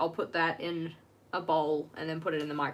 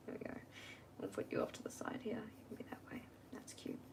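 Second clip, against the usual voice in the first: soft whisper to herself; 3 semitones higher, 14 dB quieter, much faster than usual. Real, not acted. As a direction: whisper it to the page. Murmuring to the drawing.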